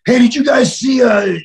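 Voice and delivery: high, loud call across the lobby. Retold or imitated. Wrestler calling across the empty lobby to two colleagues, loud. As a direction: shout to get their attention.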